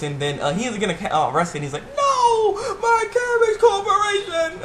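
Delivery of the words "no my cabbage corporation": very high, drawn-out mock wail; anguished cabbage man. Cabbage man getting arrested, hammed-up wail.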